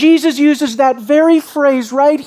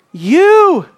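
YOU high, loud and drawn out; emphatic YOU; 8 semitones higher, 5 dB louder than usual. Shown as a demonstration. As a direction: hit YOU hard and stretch it. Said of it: "an emphatic you".

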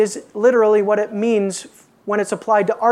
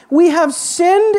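high, loud and stretched out; slow raised cry of admission; cried-out confession; 6 semitones higher, 5 dB louder, slower than usual. Acted. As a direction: call it out, rising at the end. Preacher cries out the confession.

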